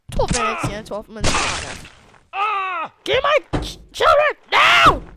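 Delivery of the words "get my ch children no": high loud panicked shout; panicked cry. Real, not acted. Gamer panics over his children, high loud cry.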